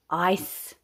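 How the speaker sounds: plain reading voice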